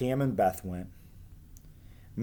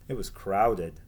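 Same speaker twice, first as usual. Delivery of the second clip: ordinary delivery, nothing marked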